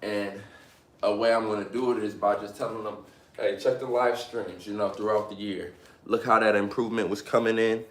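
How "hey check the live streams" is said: normal talking voice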